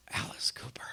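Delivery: whispering the name off the sign; hushed whisper. Retold or imitated. Reading the Cheetah Club marquee aloud, awed whisper.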